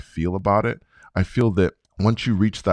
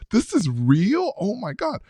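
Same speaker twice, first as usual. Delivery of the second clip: stunned disbelief; 11 semitones higher than usual. Retold or imitated. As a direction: pitch jumps way up in amazement. Retelling disbelief while rising.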